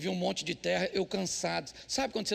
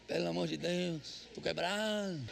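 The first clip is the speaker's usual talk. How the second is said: imitating a worn-out husband, lower and softer; mock tired husband; 4 semitones lower, 6 dB quieter, slower than usual. Husband answering his wife's chore request, mock weary groan. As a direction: groan it like you're exhausted. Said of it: "A mulher fala".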